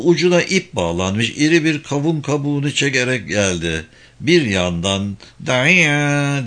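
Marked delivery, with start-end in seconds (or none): 5.5-6.4 long, drawn-out creaking moan; moaning cart imitation. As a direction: imitate a groaning ox-cart in one long cry. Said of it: "diye inliyordu".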